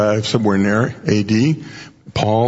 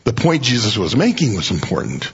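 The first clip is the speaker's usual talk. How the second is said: normal talking voice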